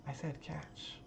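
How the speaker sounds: whispered, breathy repeat. Acted. Lena telling Alyssa again, whispered. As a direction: hiss it as an aside.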